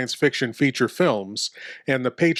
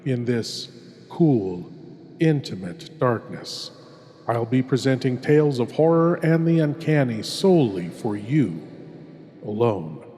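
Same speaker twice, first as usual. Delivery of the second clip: horror host. Acted. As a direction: draw the listener into the dark, savouring each word. Horror host introduces tale.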